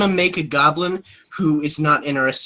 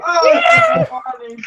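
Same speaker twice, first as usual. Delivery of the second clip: laughing; 4 dB louder than usual. Real, not acted. Laughing shout at joke.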